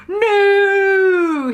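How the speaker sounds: loud, high shouted no; a long held high shout. Acted. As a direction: bellow it to stop them. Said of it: "he shouted".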